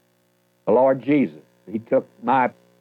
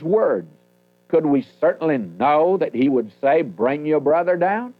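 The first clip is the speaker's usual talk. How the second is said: raised, solemn reading of the King James text; scripture reading; 4 semitones higher than usual. Acted. Preacher reads the famine verses to the congregation, solemn and raised.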